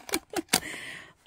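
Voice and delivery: quick airy giggle; giggling at herself; high breathy laugh. Real, not acted. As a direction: let a high laugh escape. Laughs at tossing box.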